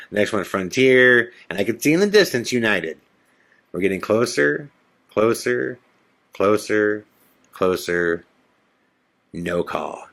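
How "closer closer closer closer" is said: drawn-out suspenseful repetition; building suspense. Real, not acted. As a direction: count down the tension as it fades.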